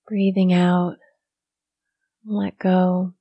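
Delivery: soft, unhurried meditation guidance with long silences; guided meditation. Real, not acted. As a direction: ease listeners into stillness, softly, leaving room to breathe.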